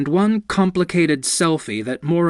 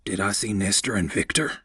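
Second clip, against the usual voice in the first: whispering; 5 semitones lower, 5 dB quieter than usual. Acted. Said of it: "He whispered to Bo".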